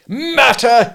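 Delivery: high, loud outraged yell. Acted. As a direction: explode at the question. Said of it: "he yelled. He really yelled".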